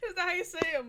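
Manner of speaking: high, helpless laughing question. Real, not acted. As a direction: ask it while cracking up. Cracking up over her pronunciation.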